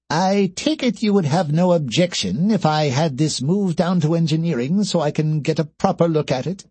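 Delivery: high-pitched, fussy character voice; busy engineer asking permission, pitched high; alien engineer. Acted.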